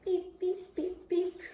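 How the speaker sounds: high, squeaky chick peeping; baby chick peeps. Shown as a demonstration. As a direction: peep like a tiny chick. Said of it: "You want me to peep like a chick?".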